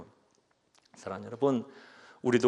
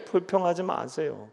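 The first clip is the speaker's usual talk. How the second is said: ordinary talk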